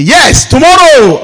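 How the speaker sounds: shouted high and slow with relief; joyful shout. Retold or imitated. Preacher reliving the car starting, shouting for joy. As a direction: cheer it out, drawn out and loud.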